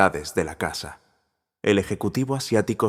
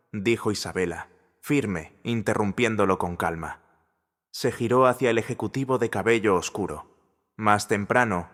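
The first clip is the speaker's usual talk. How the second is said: usual speaking voice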